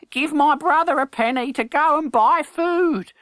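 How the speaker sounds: put-on high, fawning boy's voice; young beggar. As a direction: wheedle the stranger. Hugo's voice.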